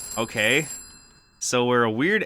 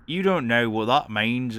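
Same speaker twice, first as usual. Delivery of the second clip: lightly put-on British voice; a hint of badly faked British; bad British accent. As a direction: slip into a rough British accent. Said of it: "that's not the British man or woman".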